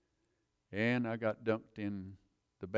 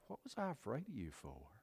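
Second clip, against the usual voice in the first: much higher, amazed inner voice; higher, soft wonder at God's love; wondering realization; 10 semitones higher, 5 dB quieter than usual. Retold or imitated. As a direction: marvel at being loved. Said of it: "I thought".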